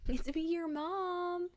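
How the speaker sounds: high sing-song mom coo with a laugh; sweet mom voice. Retold or imitated. Mom replaying her cooing greeting to her toddler, high sing-song.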